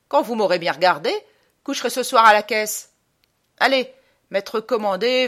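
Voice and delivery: loud, blustering officer's outburst; fly off the handle at the recruit; hammed-up colonel in a temper; colonel flaring up. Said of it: "le voilà qui part, qui monte comme une fusée".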